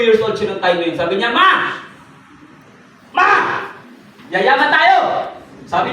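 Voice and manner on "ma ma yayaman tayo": high, loud, thrilled announcement; overjoyed cry to his mother; excited shout. Retold or imitated. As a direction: yell the good news to mom. Excited son calls mother.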